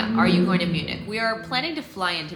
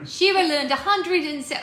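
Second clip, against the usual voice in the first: Cockney slipping into Australian accent, higher; accidental Australian accent; 9 semitones higher, 4 dB louder than usual. Shown as a demonstration. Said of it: "somehow I turned Australian".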